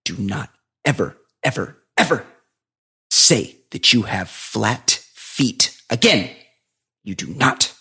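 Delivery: slow, emphatic warning with weighted pauses; imitated dead seriousness. Shoe seller forbids talk of flat feet, very seriously. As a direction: land each word separately, brooking no argument. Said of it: "He's, like, really serious".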